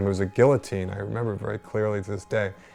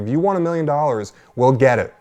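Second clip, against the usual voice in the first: pushy agent; 5 semitones higher, 5 dB louder than usual. Retold or imitated. Agent pleading, offering money.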